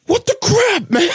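very high, squealed disbelief; hammed-up retold indignation; mock outrage. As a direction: exclaim it in exaggerated high-pitched exasperation. Retelling his outraged reaction to barista.

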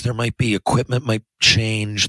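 normal talking voice

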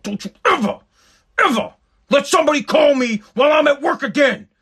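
retold angry shout, strained and rising; furious father. Father pinning son to the wall, furious shouted warning. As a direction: build to a high-pitched roar of warning.

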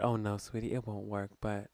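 soft, low imitation of a kindly refusal; loving letdown. Friend lets a girl down about her hairdresser, lovingly. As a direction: soothe while gently saying no. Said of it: "and so lovingly said".

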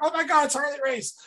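high, squealing fanboy shout; starstruck kid. Retold or imitated. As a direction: gush like a ten-year-old meeting his hero. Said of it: "I'm like".

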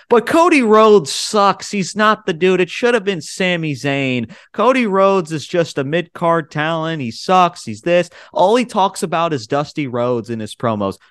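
high, slow, sneering impression of an online hater; mock whiny hater. Mocking the fans who trash Cody, in a high, slow sneer. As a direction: ham up the annoying fan complaining.

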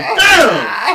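loud shouted damn. Retold or imitated. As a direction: yell it at the smell.

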